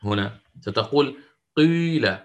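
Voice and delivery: قيل and the others with the kasra leaning towards damma, long held vowels; shuyu ishmam list. Shown as a demonstration. Teacher reciting the list for students, i leaning toward u. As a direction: recite each word with a rounded i blending toward u. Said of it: "يعني تطبيقًا كيف سيكون؟".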